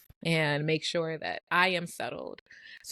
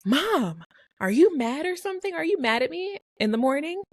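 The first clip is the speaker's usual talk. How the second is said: put-on higher, questioning child voice; retold child's quick question; imitated daughter; 10 semitones higher than usual. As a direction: lift the pitch like a worried kid asking Mom. Voices her daughter asking.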